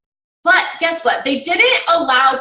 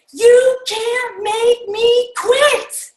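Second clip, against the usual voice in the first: screamed defiance; 10 semitones higher, much slower than usual. Retold or imitated. Harper screaming at his coach, defiant. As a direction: scream it with everything, refusing to go. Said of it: "And Harper screaming".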